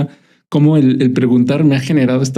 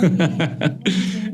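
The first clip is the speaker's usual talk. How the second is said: soft breathy laugh; 3 semitones higher, 7 dB quieter than usual. Real, not acted. Laughs at herself.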